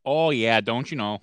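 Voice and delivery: put-on playful Wisconsin accent with rounded vowels. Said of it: "like, the Wisconsin accent".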